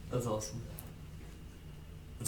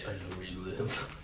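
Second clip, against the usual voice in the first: slow, soft creepy threat; hushed slow menace down the phone; heavy breathing phone whisper; 4 semitones lower, 10 dB quieter than usual. Acted.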